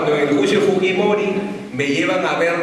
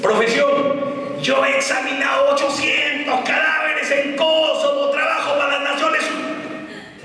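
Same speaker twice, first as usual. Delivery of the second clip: higher, heated boasting of his record; higher-pitched indignant boast; furious expert; 7 semitones higher than usual. Retold or imitated. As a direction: come in seeing red and brag. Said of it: "entra con la sangre en los ojos".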